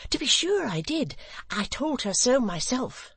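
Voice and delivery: put-on high, delighted young man's voice; eager Barnaby. A narrator's reading. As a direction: boast happily, pitch leaping up. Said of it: "with sparkling eyes".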